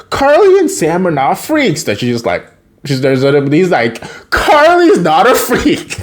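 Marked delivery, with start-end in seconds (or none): 0.1-1.8 high, protesting retort; retold indignant defence. Freddy argues with his mom, indignant. 4.3-6.0 high, slow, emphatic retort; retold indignant protest. Freddy defends Carly to mom, indignant.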